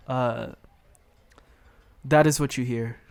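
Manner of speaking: ordinary delivery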